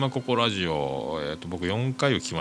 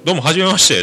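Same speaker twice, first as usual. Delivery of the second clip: bright loud hello; cheery greeting; 8 semitones higher, 10 dB louder, faster than usual. Retold or imitated. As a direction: greet them high and loud. Retold first greeting on Skype.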